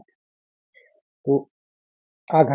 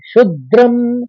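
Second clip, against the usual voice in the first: slow, raised chanting; shloka recitation; 4 semitones higher, 4 dB louder, slower than usual. Shown as a demonstration. Teacher rebuilding the third line after splitting it, chanted. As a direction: chant the words out carefully. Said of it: "पहले शुद्ध कहेंगे".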